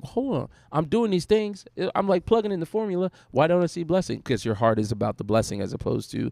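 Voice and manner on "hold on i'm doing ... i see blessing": higher, mock-indignant why-not-me; frustrated complaint. Pastor imitating a believer demanding blessing, higher mock frustration. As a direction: whine at God that the formula fails. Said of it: "you're like".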